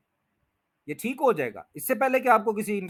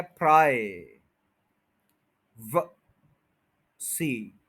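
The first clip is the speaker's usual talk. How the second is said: प्राय long, व tiny, सी apart; stress on first syllable; 4 semitones lower, much slower than usual. Shown as a demonstration. Teacher builds the word by syllables, first one stressed. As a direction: separate pieces, leaning on प्राय. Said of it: "stress जो है वो first syllable पे है".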